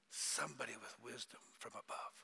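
soft hushed whisper. Real, not acted. Preacher answering his own question, whispered. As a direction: let the answer fall quietly.

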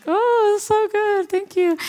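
hammed-up sweet lie about the singing; mock fake praise. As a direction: gush it insincerely. Fake praise while sitting there.

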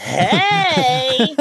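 high, long hammed-up hey; kids' sitcom greeting. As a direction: greet like a kids' show star. Plays Mikey's sitcom greeting.

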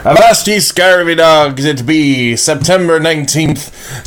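slow, hammy pirate growl; pirate accent. Acted. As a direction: ham up a bad pirate accent. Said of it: "terrible pirate accents".

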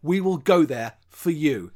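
loud, big announcer greeting; grand, emphatic host delivery; show host welcome. Acted. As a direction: welcome the crowd like a showman. Hosts' scripted show welcome.

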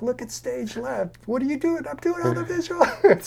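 very high-pitched, hammed-up disbelief; acted giddy excitement. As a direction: squeal it like you can't believe your luck. Acted excited exchange with friends.

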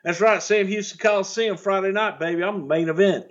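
cocky high promo voice; bragging promo. Retold or imitated. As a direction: strut it like a heel cutting a promo. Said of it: "Buddy was bragging".

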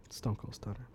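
hushed Russian whisper. Pretend. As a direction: breathe it low like a secret. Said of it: "whispers to his aide".

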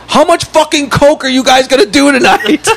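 high-pitched mock outrage; retold irate neighbor. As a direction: imitate a neighbor accusing them, voice way up. Acts out irate neighbor confronting them.

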